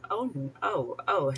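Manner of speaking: normal talking voice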